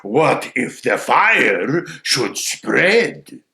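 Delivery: slow, loud crow question; needling crow. Acted. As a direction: draw out the threat as the crow. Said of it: "says the crow".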